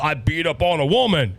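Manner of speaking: lightly voiced macho boast; a hint of a swaggering tough man; mock tough guy. Host mocking men who attacked a woman, mock tough guy.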